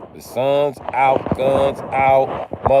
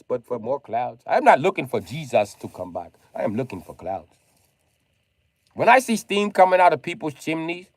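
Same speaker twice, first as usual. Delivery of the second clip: usual speaking voice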